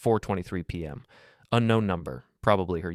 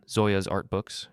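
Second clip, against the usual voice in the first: plain reading voice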